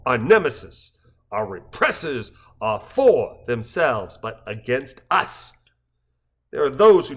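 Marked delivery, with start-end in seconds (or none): none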